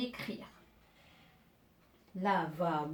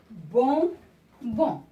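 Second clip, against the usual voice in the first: bonbon split slowly into two loud syllables; 2 semitones higher, 6 dB louder than usual. Shown as a demonstration. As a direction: say "bonbon" slowly, pausing between bon and bon. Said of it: "c'est une syllabe qui se répète deux fois". (« bonbon » lentement, syllabe par syllabe)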